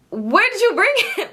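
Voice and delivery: high, incredulous squeak of a question; shocked disbelief. Retold or imitated. Teen to friend, stunned about the burger, high disbelief. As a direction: act floored she gave the food away.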